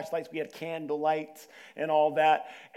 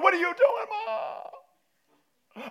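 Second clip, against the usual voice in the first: high, loud, crying mock wail at mom; a hammed-up frightened yell toward mom; scared cry; 7 dB louder, much slower than usual. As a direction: wail it like a terrified kid. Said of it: "I'm just scared".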